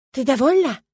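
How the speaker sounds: high, loud, furious accusation; angry hiss. A narrator's reading. Milena storms in to confront the narrator, furious. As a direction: spit the question out, loud and high. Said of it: "прошипела она".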